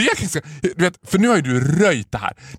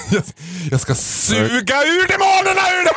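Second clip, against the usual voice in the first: gleeful exclamation; 11 semitones higher than usual. Pretend. Hyping the exorcism joke.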